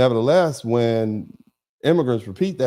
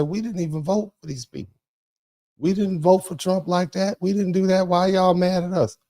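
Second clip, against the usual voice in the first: higher, put-on voice of an aggrieved Haitian voter; voicing the ninety-two percent; 9 semitones higher than usual. Host voicing Haitians who didn't vote, higher protesting voice. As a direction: play the innocent majority protesting, pitched up. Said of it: "The ninety-two percent will say".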